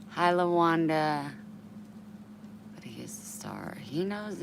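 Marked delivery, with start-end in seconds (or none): 2.7-3.8 whispering. Real, not acted. Whispered aside about dog.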